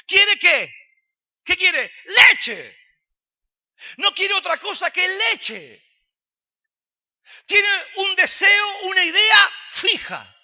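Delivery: high, loud fervent shouting sermon; a pastor shouting about the baby's single desire; preaching at full force. Acted. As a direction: drive the point home at the top of the voice.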